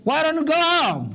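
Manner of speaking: high, bright eager suggestion. Shown as a demonstration. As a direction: pitch it up like an eager offer. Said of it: "ለምን አንሄድም በለኝ እስኪ".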